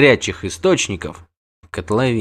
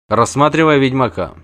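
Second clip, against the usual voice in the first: usual speaking voice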